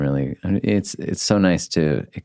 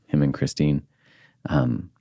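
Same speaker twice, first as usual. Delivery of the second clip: usual speaking voice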